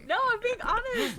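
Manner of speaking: laughing through the words; high giggling reassurance. Real, not acted. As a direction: giggle while insisting honestly. Laughing while denying.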